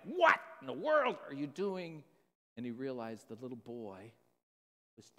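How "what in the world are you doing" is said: louder and higher retold yell at his son; mock the father's yell at the boy; yelling dad. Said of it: "just yell and scream at it like".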